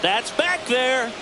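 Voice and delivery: stretched out then rising excitement; home run call. Real, not acted.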